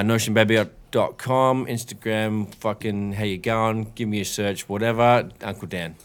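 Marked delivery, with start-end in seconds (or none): none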